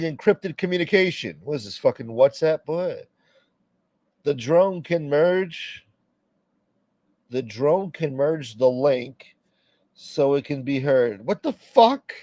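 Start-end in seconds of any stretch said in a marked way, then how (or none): 4.2-11.2 very slow, deliberate reading. Real, not acted. Reading theory slowly. 11.2-12.1 high, loud outburst of disbelief; incredulous. Real, not acted. Reacting to drone theory.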